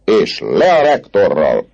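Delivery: high, loud, drawn-out protest cries; crowd jeering. Acted. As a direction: call each "down with" out like a rioting student. Crowd chanting down with officials.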